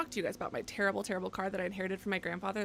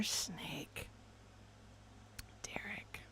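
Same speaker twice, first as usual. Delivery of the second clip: hushed; 14 dB quieter, much slower than usual. Real, not acted. Streamer reading donor names, hushed. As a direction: say it under your breath.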